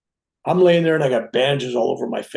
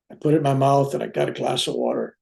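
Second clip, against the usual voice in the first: normal talking voice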